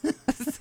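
quiet giggle; breathy little laugh; soft high laugh. Real, not acted.

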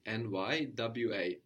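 N Y W A spelled slowly, each letter apart. Shown as a demonstration. Teacher spelling the verb slowly for the student. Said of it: "Think about each letter separately".

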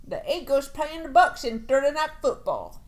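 hammy Southern twang announcing; Mississippi accent. Pretend. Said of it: "Dude, how's, how's that?".

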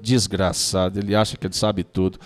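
low, soft mock grumble; acted resentful aside about someone; changed, grumbling intonation. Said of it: "Aí muda a entonação da voz".

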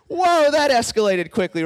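loud, high startled reaction; mock shock. Reacting as listener to Jesus saying Satan, loud mock shock. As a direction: burst out in playful alarm.

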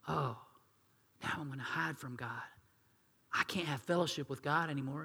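soft, sheepish murmur; hushed hiding voice. Acted. Adam and Eve hiding from God, hushed. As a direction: mumble it like you're hiding.

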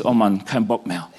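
low, dejected self-talk; mock giving up, voice dropped low; mutedly fed up, thinking aloud; acted discouragement. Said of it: "Du beziehst das auf dich und denkst".

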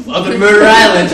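loud deadpan pilot announcement. Retold or imitated. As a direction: announce it like an airline captain. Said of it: "perfect pilot voice".

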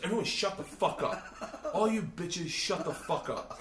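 high, exasperated rebuke without extra volume; acted angry order. Igor silencing the class meeting, acted angry order. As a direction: silence the room, slowing on the repeat.

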